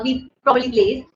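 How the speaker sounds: ordinary delivery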